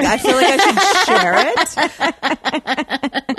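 slow, laughing it out. Real, not acted. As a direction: giggle through the line, drawn out. Laughing while proposing to post.

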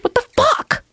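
high, disbelieving outburst; outraged. Real, not acted. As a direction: blurt it out in disbelief. Outraged at store policy.

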